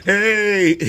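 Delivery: loud hey breaking into high laughter; cheering hey. Real, not acted. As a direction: cheer then crack up. Cheering about being on break.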